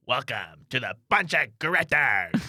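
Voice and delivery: slow, loud, high villain voice; Green Goblin voice. Acted. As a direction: announce it like a cackling supervillain. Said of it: "Green Goblin voice".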